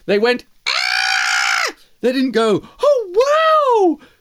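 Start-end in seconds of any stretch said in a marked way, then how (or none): 0.6-1.8 short loud scream of fright; mouse shriek. Acted. Caught mouse lets out a loud shriek. 2.8-4.1 mock awe. Eagle mimics the mouse.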